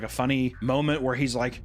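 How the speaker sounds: normal talking voice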